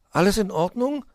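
besorgte Frage in hoher Stimme; besorgt. A narrator's reading. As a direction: frag besorgt nach, am Ende steigend. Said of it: "fragte Willi besorgt". (ein wenig besorgt)

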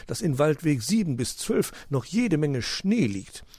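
high, hooting owl voice; owl uncle. A narrator's reading. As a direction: scold lightly with a hooted huhu.

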